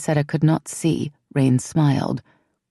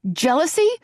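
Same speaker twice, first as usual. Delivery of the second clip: much higher, rising outraged question; outraged disbelief; 13 semitones higher than usual. A narrator's reading. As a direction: throw the word back, incredulous.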